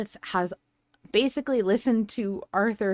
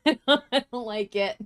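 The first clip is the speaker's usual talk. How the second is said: laughing; 5 semitones higher than usual. Real, not acted. Laughing while repeating the complaint.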